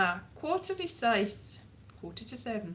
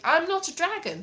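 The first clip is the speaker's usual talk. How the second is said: mock protest; 7 semitones higher, 4 dB louder than usual. Acts out arguing with the app.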